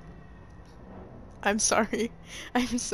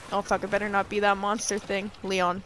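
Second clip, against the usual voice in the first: ordinary talk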